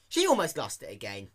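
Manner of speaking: plain reading voice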